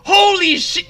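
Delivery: high, loud holy shit; excited outburst. Real, not acted. Streamer still thrilled about the money, excited outburst. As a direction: let it burst out in joy.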